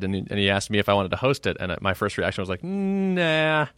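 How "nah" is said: drawn-out, higher-pitched "nah"; reluctant refusal. Retold or imitated. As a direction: imitate a hesitant, unconvinced first reaction. Retold reluctant first reaction to hosting.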